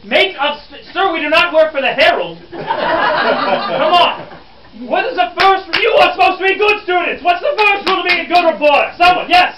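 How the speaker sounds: loud, high-pitched scolding; mounting exasperation. Acted. Reporter berating supposed good students, increasingly exasperated. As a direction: build into a shouted demand for the answer.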